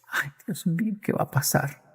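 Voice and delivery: soft, hushed fretting grandmother; soft grandmother fretting "Ay, Dios mío"; worried hush. Acted. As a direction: murmur it like an anxious old woman. Fearful grandmother voiced in story.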